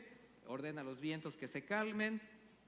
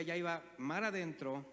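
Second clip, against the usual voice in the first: normal talking voice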